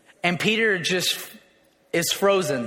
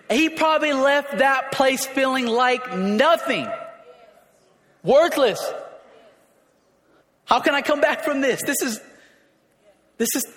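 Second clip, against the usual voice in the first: intense preaching; 6 semitones higher than usual. Acted. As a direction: lean into the despair.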